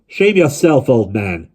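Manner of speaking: shouting. A narrator's reading. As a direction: shout at the old man. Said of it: "shouted Dai the postman".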